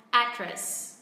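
"Address" as AD-dress, strong first syllable, second dropping away; stress on first syllable. Shown as a demonstration. Teacher models the American way for learners, first-syllable stress on "Address". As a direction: punch the "Ad" of "Address", let "dress" fall. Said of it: "mình sẽ xuống giọng âm tiết thứ hai".

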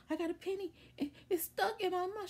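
put-on high-pitched, worried kid voice; retold child's panic. Little girl telling her mom about the stuck penny, retold panic. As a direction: report it urgently, pitch right up.

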